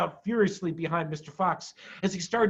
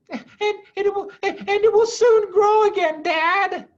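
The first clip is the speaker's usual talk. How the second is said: put-on much higher, loud childlike voice; a small child hopeful for Dad; little fox child; 8 dB louder than usual. A narrator's reading. Small fox talks to father.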